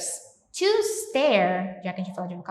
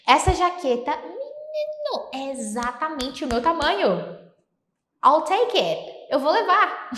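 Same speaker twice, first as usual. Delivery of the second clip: thrilled shopper; 6 semitones higher, slower than usual. Acted. Teacher playing a thrilled shopper in a store, excited. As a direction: gush over the perfect jacket.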